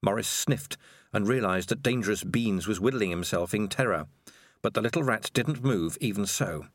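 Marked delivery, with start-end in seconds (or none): none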